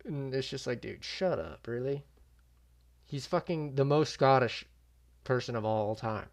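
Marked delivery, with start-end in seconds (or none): none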